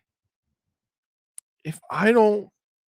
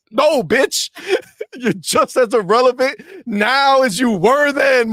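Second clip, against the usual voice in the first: usual speaking voice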